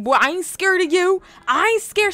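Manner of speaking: voicing a defiant girl; sassy retort. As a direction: she snaps back she's not scared. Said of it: "And she's like".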